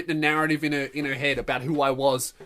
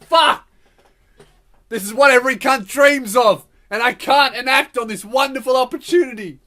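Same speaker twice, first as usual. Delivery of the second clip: loud, high exasperated rant; frustrated outburst; 6 semitones higher, 7 dB louder than usual. Acted. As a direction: open with a yelled curse, keep ranting loudly. Rants at missing his chance.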